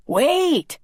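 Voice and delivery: high, loud call from behind. Acted. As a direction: stop him with one shout. Carlos calls after Robbie.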